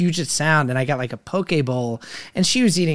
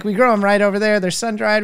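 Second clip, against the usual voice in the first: put-on Italian accent; 5 semitones higher, 4 dB louder than usual. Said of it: "this is a really great Italian accent I'm doing".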